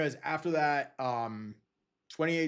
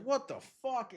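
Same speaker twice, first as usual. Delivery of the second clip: stunned disbelief; 11 semitones higher than usual. Retold or imitated. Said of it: "Everyone's like".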